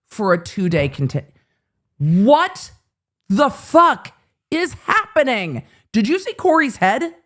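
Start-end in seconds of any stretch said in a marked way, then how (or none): 2.0-5.6 slow, stretched-out what the fuck; drawn-out disbelief. Real, not acted. Stunned by the court livestream.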